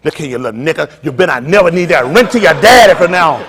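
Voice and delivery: mock threat to the kids, rising to loud; imitating his wife. Wife threatening the kids about their daddy, lightly mocked. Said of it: "This how she is".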